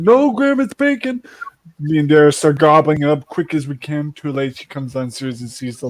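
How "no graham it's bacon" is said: high, sweet lying voice; fake innocent. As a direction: cover the lie with a high sweet tone. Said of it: "So we said".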